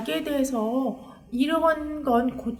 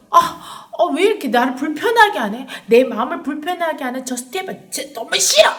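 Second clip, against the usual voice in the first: irritated complaint; 2 semitones higher, 7 dB louder than usual. Acted. Crowd resenting Stephen's words.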